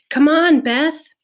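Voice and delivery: loud, high urging; urgent call. A narrator's reading. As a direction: call her in, pressing. Grandmother urging mother to shelter.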